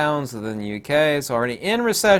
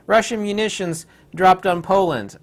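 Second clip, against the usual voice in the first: mock alarmed outrage; 7 semitones higher, 4 dB louder than usual. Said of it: "You'd be like".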